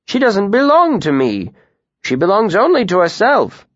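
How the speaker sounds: a cheerful, lively tinker. A narrator's reading.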